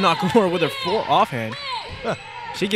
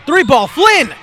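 a higher, louder burst as the shot goes up; excited shout; 7 semitones higher, 8 dB louder, slower than usual. Real, not acted. Announcer shouting as Flynn shoots a three, excited. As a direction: call the three with full energy.

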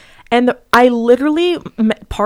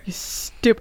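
ordinary talk

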